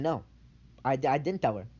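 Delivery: ordinary talk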